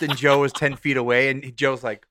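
ordinary delivery

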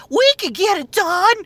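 mock the chirpy robot helper; mock ghost voice. Mimicking the ghost's line.

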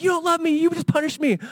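put-on high, lightly whiny child voice; a kid complaining about being punished; imitating his son. Preacher imitating his son complaining, high and whiny. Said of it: "He's like".

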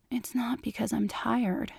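soft breathy whisper. A narrator's reading. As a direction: whisper it close to his ear. Intimate confession in bed.

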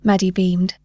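ordinary delivery, nothing marked

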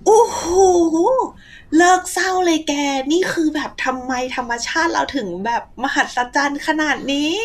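retelling her own awe after reading; amazed. Retold or imitated. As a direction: marvel at it, loud and high. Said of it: "พออ่านเสร็จก็แบบ".

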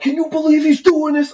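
mock outrage. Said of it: "people are like".

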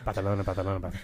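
Barcelona said with a "th" for the c, Spanish-style; Catalan-style lisp. Shown as a demonstration. Host imitating what he heard in Spain, lisped Spanish accent. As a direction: mimic Spain's lisped Barcelona, repeated quickly. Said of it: "Catalonian is definitely different than the rest of Spain".

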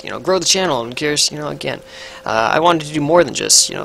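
normal talking voice

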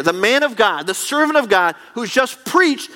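ordinary talk

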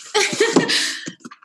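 breathy tearful laugh; teary laugh. Real, not acted. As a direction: laugh through the tears. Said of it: "why am I crying?".

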